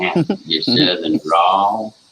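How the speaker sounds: slow, stretched Southern drawl, lightly done; mock Southern drawl. Teasing a streamer about his Southern drawl, slow mock Southern drawl. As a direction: draw it out like a lazy Southern drawl. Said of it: "you have a Southern drawl".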